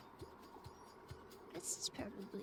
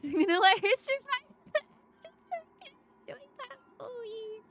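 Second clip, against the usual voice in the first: high, laughing through the words; 12 semitones higher, 12 dB louder than usual. Real, not acted. Streamer laughing at a barfing sound effect, laughing. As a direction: giggle it out, much higher and louder.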